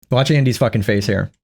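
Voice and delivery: normal talking voice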